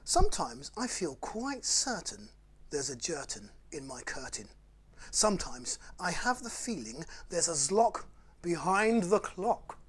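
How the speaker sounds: playful bedtime story reading; reading rhymes to children. Acted. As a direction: read the silly rhymes to the kids with relish. Reads Dr Seuss aloud.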